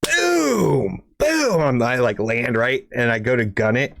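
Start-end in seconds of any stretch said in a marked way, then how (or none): 0.0-1.6 high mock boom; high imitated impact boom; crash boom. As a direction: make the crash sound. Car hitting the ground.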